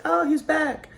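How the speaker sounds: high, mock-surprised exclamation; mock surprise. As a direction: greet him with hammed-up surprise. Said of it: "everybody's like".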